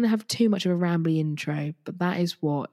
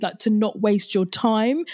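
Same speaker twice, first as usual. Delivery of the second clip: ordinary talk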